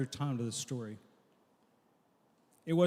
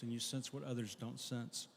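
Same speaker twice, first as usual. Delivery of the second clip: softly repeated; 2 semitones lower, 10 dB quieter than usual. Shown as a demonstration. Same line repeated much softer.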